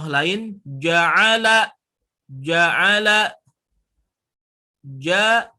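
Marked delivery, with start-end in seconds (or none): none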